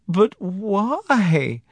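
high, drawn-out, bewildered cry; tearful. A narrator's reading. Wife questioning her husband, tearfully. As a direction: plead for a reason through tears. Sobbing wife asks for reasons.

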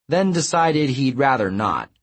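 plain reading voice

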